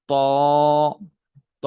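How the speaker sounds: ত said with rounded lips. Shown as a demonstration. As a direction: round the mouth for ত. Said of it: "একটু গোল করে ফেলবো".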